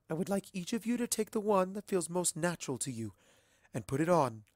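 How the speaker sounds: lightly voiced stately ruler; regal princess instructing. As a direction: guide your subjects with poise. Celestia instructs the ponies.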